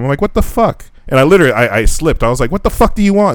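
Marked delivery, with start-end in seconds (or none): 0.2-0.8 high-pitched retold challenge; mock confrontation, pitch jumping up; angry outburst. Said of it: "I just fucking mad dog 'em". 2.5-3.3 high, indignant retold outburst; worked-up quote, pitch leaping up; angry challenge. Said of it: "It just, I got angry".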